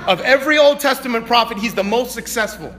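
high, loud preaching that swells into shouts; full-force preaching. Acted. Preacher rallying the congregation to reach everyone, shouting. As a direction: preach it hard, driving the message home.

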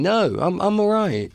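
bashful high-pitched demurral; squeaky mock protest. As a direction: play shy, squeak it out high. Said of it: "I said".

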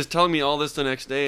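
normal talking voice

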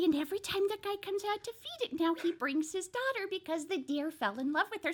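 ordinary talk

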